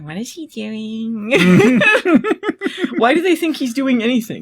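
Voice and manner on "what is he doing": slow, hushed words forced through the teeth; through gritted teeth. Retold or imitated. Piper asking about the baby, hushed through gritted teeth. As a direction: mutter it tensely through clenched teeth. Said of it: "through her teeth".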